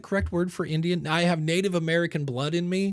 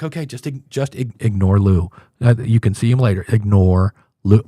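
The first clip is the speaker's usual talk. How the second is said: inner command; 6 semitones lower, 11 dB louder than usual. Retold or imitated. Host ordering himself to ignore Lou, much louder and lower. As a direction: bark at yourself to ignore him. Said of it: "And I'm like".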